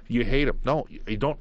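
ordinary delivery

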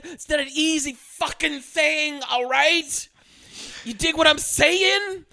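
overplayed desperate mom pitching her kid; over-the-top junkie mom. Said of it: "giving a hundred and fifty percent".

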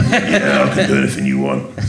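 low, laughing tough-guy answer; deep and cocky, half laughing; gruff action-star boast; Jason Statham reply. Retold or imitated. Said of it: "it's like someone's gone to him and gone".

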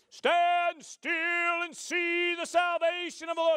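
loud, high, slow proclaiming shout; hammed-up biblical command; heroic Moses. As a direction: boom it out like an epic movie prophet. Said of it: "We got a man standing there".

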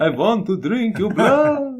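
high slow Dracula voice; slow hungry vampire line. Pretend. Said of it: "I can do a good, um, vampire".

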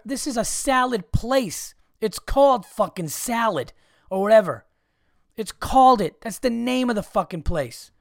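high-pitched, emphatic rant; exasperated. Real, not acted. Customer ranting about a place named for salad, exasperated. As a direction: hammer the obvious, pitch jumping up.

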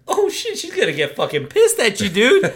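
high-pitched "uh-oh" viewer reaction; mock alarm. Viewer reacting to the stump gift, mock alarm. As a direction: blurt it like a worried viewer, half laughing.

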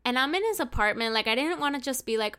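normal talking voice

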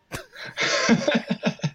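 breathy little laugh at himself; self-mocking laugh. Real, not acted. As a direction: laugh off the joke about himself. Laughs at own self-deprecation.

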